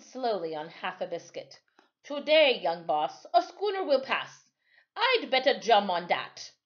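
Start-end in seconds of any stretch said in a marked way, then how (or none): 2.1-6.5 put-on a higher, louder old seaman's voice; lightly voiced old sailor; old island sailor. Timothy voiced in story.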